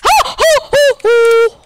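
high, loud frightened oh; startled yelps. Real, not acted. Gamer yelps as he nearly falls. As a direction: yelp in alarm. Near death in game.

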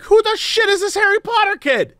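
high, outraged whiny rant; jealous student rant. Pretend. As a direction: whine like a jealous classmate. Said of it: "day one, I'd be like".

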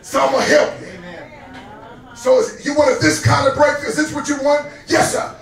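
fervent preaching. Acted. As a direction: call it out to the whole congregation. Preaches at full force.

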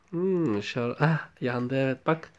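ordinary talk

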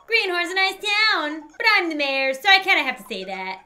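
high-pitched character voice; Kirby the mayor. Acted. Mayor welcoming an adventurer, high character voice.

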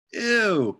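high, grossed-out "Ew"; disgusted. Acted. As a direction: recoil at the idea. Girl disgusted by peanut butter.

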